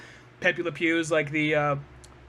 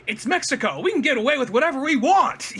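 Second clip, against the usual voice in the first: brash cartoon troublemaker, higher and louder; Daffy Duck; 7 semitones higher, 5 dB louder than usual. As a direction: crow it like a cocky cartoon character. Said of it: "Daffy Duck's like".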